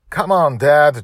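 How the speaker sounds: high, loud call to Dad; excited yell. A narrator's reading. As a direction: yell it out, egging him on. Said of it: "Dragon Little yelled".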